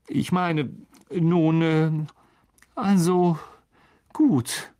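sheepish hesitation between every word; embarrassed. A narrator's reading. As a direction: fumble for words, then give in. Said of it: "sah sehr verlegen aus".

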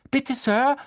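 hohe, laute Mädchenstimme; flehende Hermine. A narrator's reading. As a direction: den Lehrer eindringlich anflehen. Hermine bittet den Lehrer. (flehend, hoch und laut)

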